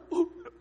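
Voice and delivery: a quick real laugh; short laugh. Real, not acted. Preacher laughing at his own fake sob. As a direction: laugh at your own slip.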